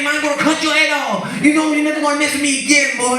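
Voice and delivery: high-pitched furious rant of a street tough; mock-raging man yelling into a payphone; angry Latin American man. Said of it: "he was really, really angry".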